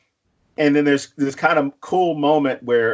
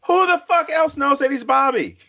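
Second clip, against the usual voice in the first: incredulous outburst; 10 semitones higher than usual. Retold or imitated. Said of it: "I'm like".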